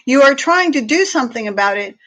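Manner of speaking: ordinary delivery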